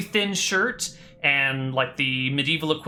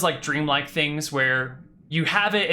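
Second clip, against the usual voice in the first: ordinary delivery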